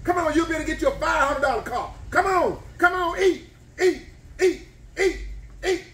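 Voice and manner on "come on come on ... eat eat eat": a fan egging on a contestant; cheering on. Real, not acted. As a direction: urge the eater on to beat the clock. Cheering eater to finish.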